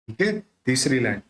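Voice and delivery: ordinary delivery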